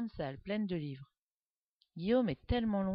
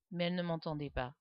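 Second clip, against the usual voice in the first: ordinary delivery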